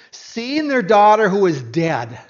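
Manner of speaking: ordinary talk, nothing marked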